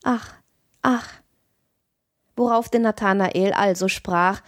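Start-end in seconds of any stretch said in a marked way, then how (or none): 0.0-1.2 breathy doll-like sighs, higher; a mechanical doll sighing. A narrator's reading. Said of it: "seufzte ein übers andere".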